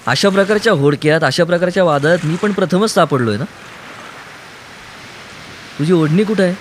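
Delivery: a girl mocking him gently; lightly voiced young woman. A narrator's reading. Kaumudi teases Aruni in the boat.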